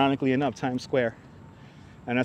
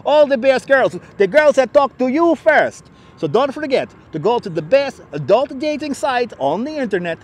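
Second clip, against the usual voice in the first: put-on high, loud Russian-accented sales pitch; 6 semitones higher, 4 dB louder than usual. Streamer mocking a dating-site spam ad, Russian accent. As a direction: hawk it in a thick Russian accent. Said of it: "Russian accent".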